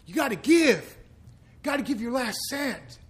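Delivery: hammed-up preacher pushing "You gotta give"; mocking church pledge voice. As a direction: lampoon the churches asking for the last cent.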